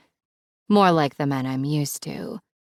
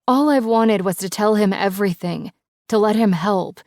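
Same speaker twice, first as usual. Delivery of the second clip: ordinary delivery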